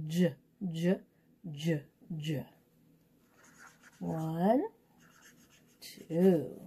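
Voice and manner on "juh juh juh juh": "J" as a low, short "juh"; letter sound. Shown as a demonstration. Teacher searching the page for J's, each "J" sounded out as juh. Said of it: "sound out this letter J sound".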